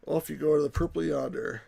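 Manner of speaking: ordinary delivery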